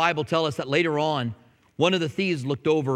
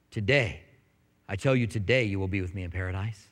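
lower, softer, tender voice of God; gentle promise; 9 semitones lower, 4 dB quieter than usual. Retold or imitated. Preacher voices God reassuring the repentant sinner, low and tender. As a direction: speak it as a loving assurance.

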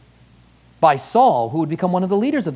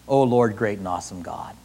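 much softer, low reverent murmur; hushed reverence; 9 semitones lower, 5 dB quieter than usual. Retold or imitated. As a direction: breathe it out in awe. Repeats prayer softer.

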